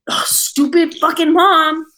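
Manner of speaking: slow, exasperated grumble; annoyed groan. Real, not acted. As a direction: drag it out, fed up. Venting about mom again.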